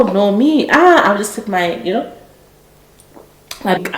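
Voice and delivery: ordinary talk, nothing marked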